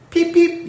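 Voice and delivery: high loud peep peep; whistle peeps. A narrator's reading. Edward whistling as he sets off, high peeps. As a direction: toot like a little engine whistle. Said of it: "he whistled".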